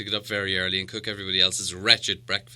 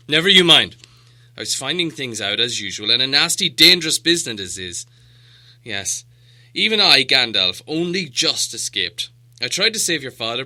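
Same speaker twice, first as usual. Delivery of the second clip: louder, emphatic wizard telling a dark tale; stern old wizard; 3 semitones higher, 7 dB louder than usual. A narrator's reading. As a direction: Gandalf booming then sinking grave. Gandalf voiced in story.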